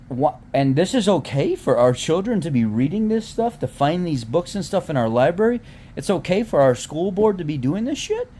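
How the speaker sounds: climbing indignant tirade; rising outrage. Real, not acted. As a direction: build from upset to near shouting at parents. Outraged rant about school books.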